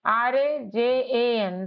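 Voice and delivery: slow, separated letters; slow spelling. Shown as a demonstration. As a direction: spell it out slowly for children. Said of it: "राजन में". (धीरे-धीरे spelling)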